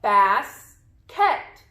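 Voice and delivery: "Basket" as bas ... ket, long pause between; split into syllables. Shown as a demonstration. Teacher has pupils count syllables, "Basket" pulled apart as bas, ket. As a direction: break "Basket" in two, each syllable fully voiced. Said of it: "Let's count the syllables".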